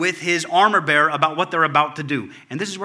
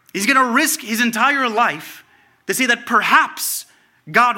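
louder, higher, building preacher's cry; fervent preaching; 6 semitones higher, 6 dB louder than usual. Acted. Pastor urging the congregation, loud fervent preaching. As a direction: preach it out with rising fire.

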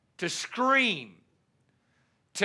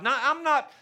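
ordinary talk, nothing marked

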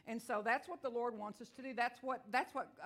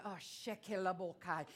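tongues utterance; 5 semitones lower, 4 dB quieter than usual. Real, not acted. Speaking in tongues mid-sermon.

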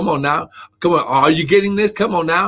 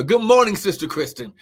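ordinary delivery

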